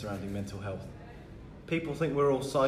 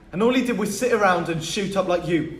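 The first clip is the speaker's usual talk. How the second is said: heated accusation; 8 semitones higher, 11 dB louder than usual. Acted. Quarrel over absent father.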